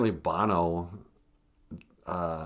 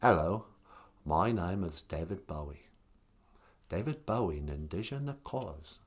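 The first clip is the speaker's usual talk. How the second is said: softer, laid-back English rock star; 3 semitones lower, 8 dB quieter than usual. Retold or imitated. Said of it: "when I do a [name] impersonation ... really quiet and passive".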